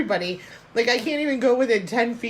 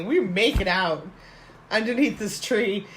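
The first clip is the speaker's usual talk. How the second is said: ordinary talk, nothing marked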